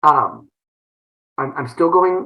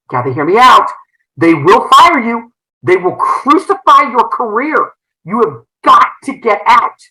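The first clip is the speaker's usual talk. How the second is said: urgent warning; 6 semitones higher, 5 dB louder than usual. Retold or imitated. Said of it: "And he said".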